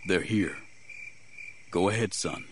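slightly hushed, restrained instruction; a touch soft, urging him on; said softly. A narrator's reading. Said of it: "he said softly".

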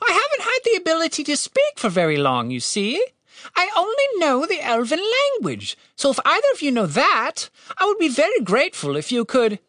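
high-pitched bird character voice; polite talking bird. A narrator's reading.

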